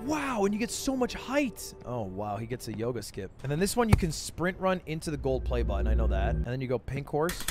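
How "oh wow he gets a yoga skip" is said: ordinary delivery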